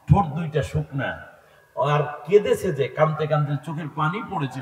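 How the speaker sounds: normal talking voice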